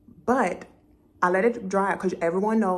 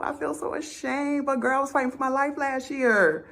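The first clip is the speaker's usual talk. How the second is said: high-pitched, sheepish excuse-making; acted-out mortified confession; ashamed pleading; 9 semitones higher than usual. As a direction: plead your case in a high, embarrassed voice. Confessing shame to her hairdresser.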